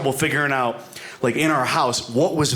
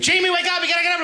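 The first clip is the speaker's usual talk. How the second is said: wife waking him; faster than usual. Retold or imitated. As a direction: squeal like an overeager wife. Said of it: "she'd wake me up, like".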